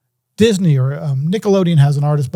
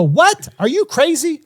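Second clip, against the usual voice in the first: much higher, incredulous squeak; mock shock; 11 semitones higher, slower than usual. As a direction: play the stunned label exec, pitched way up. Label reacts to refusal.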